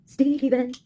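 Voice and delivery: ordinary talk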